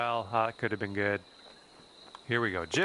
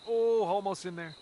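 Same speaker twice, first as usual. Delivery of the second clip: excited near miss; 7 semitones higher than usual. Real, not acted.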